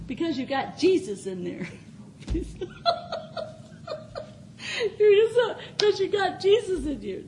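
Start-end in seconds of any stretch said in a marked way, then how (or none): 1.7-4.3 a high, airy burst of laughter pouring out of her; high breathy joyful laugh. Real, not acted. Preacher overcome with joy before the congregation, breathless laugh. 5.0-7.3 words breaking with joyful laughter; laughing through tears. Real, not acted. Preacher telling the congregation about Jesus, laughing through tears.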